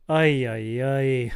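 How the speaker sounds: dry, unimpressed monotone; deadpan. Shown as a demonstration. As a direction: say it totally flat and dry. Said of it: "in a more deadpan voice".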